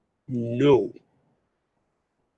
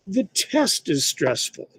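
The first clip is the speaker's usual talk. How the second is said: usual speaking voice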